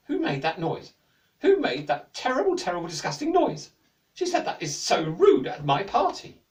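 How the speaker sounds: high, loud indignant demand; the Queen scolding the room, outraged. A narrator's reading. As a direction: demand who made the noise.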